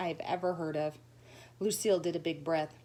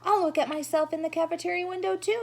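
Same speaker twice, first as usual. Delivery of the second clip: high, loud calling after her; shout; 11 semitones higher, 5 dB louder than usual. Acted. Sheldon shouting after Lucille to wait up. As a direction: yell it after her as she leaves. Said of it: "he began to shout".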